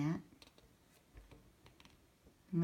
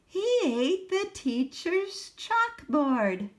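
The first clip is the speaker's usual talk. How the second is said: put-on reading to children; 4 semitones higher, 6 dB louder, slower than usual. A narrator's reading. Reads picture book aloud.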